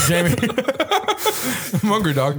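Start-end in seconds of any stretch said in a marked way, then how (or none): 0.4-1.7 sudden squeaky burst of laughter; high laugh. Real, not acted. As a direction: crack up high and breathy. Laughing at a joke.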